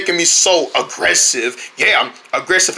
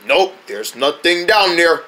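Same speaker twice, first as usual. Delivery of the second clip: usual speaking voice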